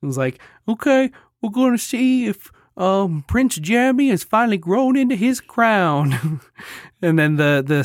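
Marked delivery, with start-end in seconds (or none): 0.7-6.4 high, lilting onlooker voice; eager spectator. Acted. Adoring public watching the king, high eager voice. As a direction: play an expectant onlooker, pitched well up.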